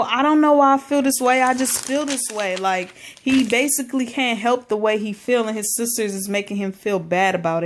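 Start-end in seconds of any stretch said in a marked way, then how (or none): 0.0-2.5 mild pleading. Retold or imitated. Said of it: "Miles is just crying his heart out".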